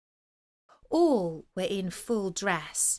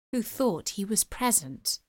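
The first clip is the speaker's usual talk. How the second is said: usual speaking voice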